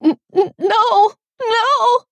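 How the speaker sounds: very high, loud stammered cries; stuttering, high-pitched panic; panicked stutter. A narrator's reading. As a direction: stammer into a terrified wail. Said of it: "I started to stutter".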